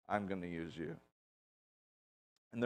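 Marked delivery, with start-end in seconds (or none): 0.1-0.9 low, hushed quoted promise; quiet assurance. Retold or imitated. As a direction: say it softly and low, as God calling. God's quiet call to shepherd.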